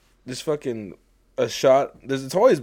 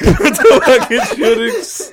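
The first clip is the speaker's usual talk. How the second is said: loud, higher, laughing wrap-up; laughing loudly; 9 semitones higher, 12 dB louder, slower than usual. Real, not acted. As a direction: laugh your way through the conclusion. Laughing wrap-up of his rant.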